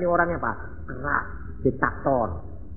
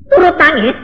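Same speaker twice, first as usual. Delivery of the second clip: emphatic cry; 12 dB louder than usual. Real, not acted. Climactic preaching.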